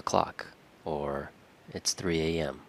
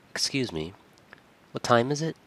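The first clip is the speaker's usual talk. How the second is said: rising, puzzled question; confused, polite; 7 semitones higher, faster than usual. Shown as a demonstration. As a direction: sound unsure and polite, pitch lifting at the end. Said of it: "how you kind of sound confused there".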